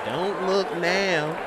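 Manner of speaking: mock country grandma. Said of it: "grandma country voice on point".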